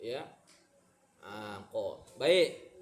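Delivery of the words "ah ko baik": ordinary talk, nothing marked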